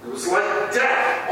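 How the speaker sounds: ordinary delivery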